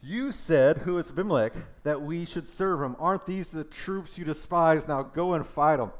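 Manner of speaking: taunting challenge. Acted. Zebul throwing Gal's boast back, loud taunt. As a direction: goad him into the fight, high and loud.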